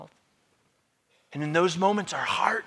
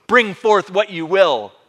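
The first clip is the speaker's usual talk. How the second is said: loud, slow, raised challenge; defiant; 6 semitones higher, 12 dB louder, slower than usual. Acted. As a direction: dare them to do their worst. Acting Polycarp's final defiance.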